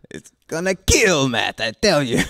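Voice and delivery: high, loud put-on voice; loud foreign voice. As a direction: bellow it like a boastful recruiter. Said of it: "they were serious when they said".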